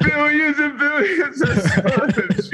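high, laughing through words. Real, not acted. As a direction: laugh while saying it. Laughing at the huge idea.

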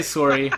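"sorry" with a rounded o, like "sore-ee"; hoped-for Canadian pronunciation. Retold or imitated. Disappointed friend showing the hoped-for Canadian sorry, imitating. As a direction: say "sorry" Canadian-style, first syllable like "sore". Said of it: "I was hoping you'd say sorry".

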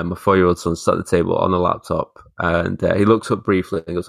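ordinary talk, nothing marked

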